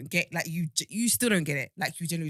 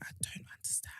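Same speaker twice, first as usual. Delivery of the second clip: whispered under the breath; a hushed, baffled aside; 18 dB quieter, faster than usual. Real, not acted. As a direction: drop to a disbelieving whisper. Baffled aside after outraged question.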